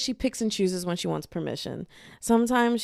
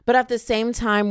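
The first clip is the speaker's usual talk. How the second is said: ordinary delivery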